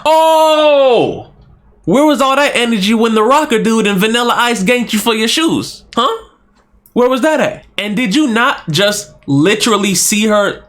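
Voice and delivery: exasperated yelling. Real, not acted. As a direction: shout at the screen in disbelief. Yelling at the movie character.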